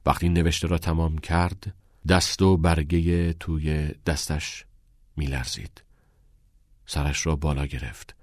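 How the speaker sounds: usual speaking voice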